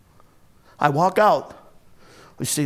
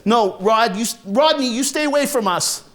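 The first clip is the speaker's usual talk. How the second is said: higher, raised-voice refusal; upset warning; 6 semitones higher than usual. Acted. Little brother Chris shields Les from Rodney, upset warning. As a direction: push him away with a rising cry.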